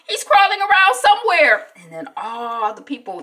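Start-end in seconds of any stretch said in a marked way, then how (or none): 0.0-1.7 put-on high, loud panicked kid call; alarmed child yell. Annie tells Dad the lizard is gone, alarmed yell. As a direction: imitate a child hollering for dad.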